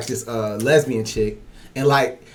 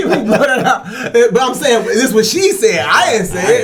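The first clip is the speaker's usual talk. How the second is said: higher, laughter through the words; laughing while talking; 8 semitones higher, 4 dB louder than usual. Real, not acted. Laughing at his own story.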